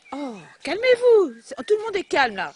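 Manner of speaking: owner loudly urging the excited dogs to calm. Real, not acted.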